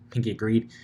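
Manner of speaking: usual speaking voice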